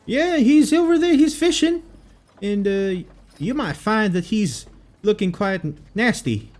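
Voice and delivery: very high, squeaky townsfolk voice; squeaky NPC voice. Acted. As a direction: pitch way up like a squeaky local.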